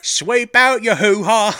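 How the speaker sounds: loud, high, slow mock-Cockney. Podcaster as a lewd chimney sweep, hammy Cockney. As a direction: belt it in a terrible Cockney accent. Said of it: "With his terrible British accent".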